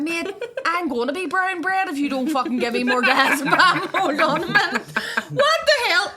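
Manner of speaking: high, loud laughing retort; mock outrage through laughter; cracking up mid-quote. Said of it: "I'd went".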